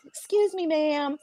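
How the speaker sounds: high, soft, careful politeness; overly polite deference. Retold or imitated. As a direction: shrink yourself with a sweet little excuse me. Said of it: "But I found myself like".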